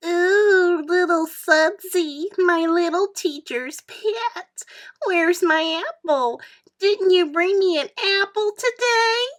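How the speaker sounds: high, slow sing-song taunting; a bully teasing a classmate with kissing noises, high and mocking. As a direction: coo it like a sneering bully teasing the teacher's pet. Bully mocks teacher's pet.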